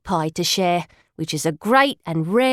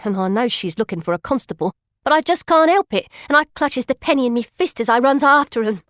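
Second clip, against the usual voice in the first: usual speaking voice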